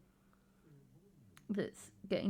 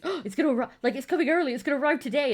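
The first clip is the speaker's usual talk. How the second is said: high, loud, eager burst; excitedly acting out anticipation; mock excitement; 7 semitones higher, 7 dB louder, much faster than usual. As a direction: get carried away with hope. Acts out hope at delivery notification.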